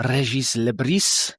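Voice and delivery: put-on name drawn out slowly in a French accent; slow, French-accented announcement. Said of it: "I'll not do that accent".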